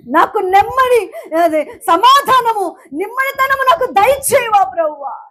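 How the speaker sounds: fervent Telugu prayer-preaching; preaching at full force. Acted. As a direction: cry out to Jesus from the pulpit.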